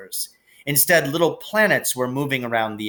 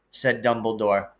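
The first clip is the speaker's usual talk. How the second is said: ordinary delivery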